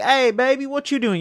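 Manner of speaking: high, bright bro voice imitating a buddy; manly bro greeting. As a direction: sing out a casual hey baby to a friend. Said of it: "not like a romantic baby".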